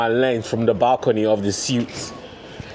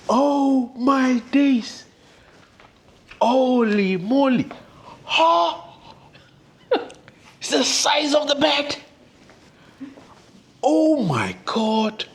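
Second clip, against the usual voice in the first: high, drawn-out squeals of amazement; real excitement; 11 semitones higher than usual. Real, not acted. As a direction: gush in high-pitched, stretched-out disbelief. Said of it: "I'm just so excited".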